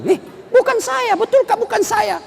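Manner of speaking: a husband begging to be believed; pleading husband. Acted. Suami membela diri pada istri. (suami panik menyangkal dengan suara tinggi)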